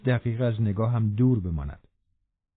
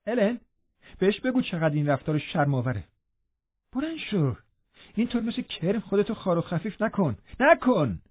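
higher, contemptuous scolding; flushed with anger; 6 semitones higher than usual. A narrator's reading. Catherine scolding trembling Linton, contemptuous and heated. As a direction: scold him with disdain. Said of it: "کاترین برافروخته گفت".

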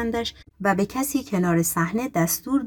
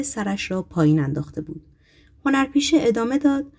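ordinary delivery

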